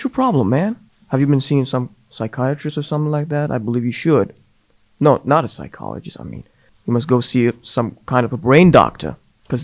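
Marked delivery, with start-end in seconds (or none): none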